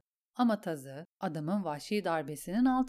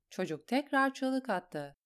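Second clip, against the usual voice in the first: usual speaking voice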